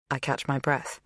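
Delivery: ordinary delivery, nothing marked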